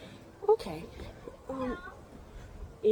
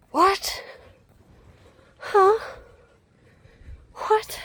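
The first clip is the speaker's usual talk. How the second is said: loud high baffled what; loud high disbelief at a baffling zoo sign; baffled shout; 11 semitones higher, 6 dB louder, much slower than usual. Real, not acted. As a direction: yell your total confusion at the sign.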